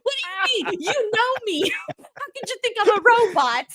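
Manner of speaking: high, indignant disbelief; high, half-laughing mock offense; mock outrage. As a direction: protest like you're wounded. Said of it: "And I'm like".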